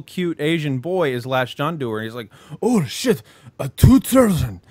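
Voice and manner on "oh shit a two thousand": put-on startled boy voice. Boy voiced, high, far.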